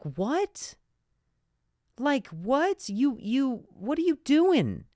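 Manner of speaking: high, incredulous what; baffled. Retold or imitated. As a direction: sound stunned and baffled. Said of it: "And you're like".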